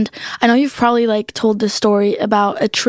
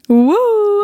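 excited cheer; 11 semitones higher than usual. Real, not acted. Host cheers welcoming the guest.

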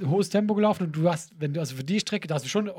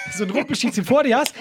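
ordinary talk, nothing marked

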